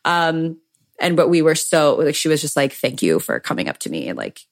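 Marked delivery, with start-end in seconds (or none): 2.7-4.4 low, soft loving thanks; tender thanks. Retold or imitated. Mama Gina softly thanking the fan who came up. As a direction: say it lovingly and quietly. Idol's tender words retold.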